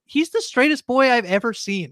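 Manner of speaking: mock Elle's blind certainty, slowly. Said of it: "is like".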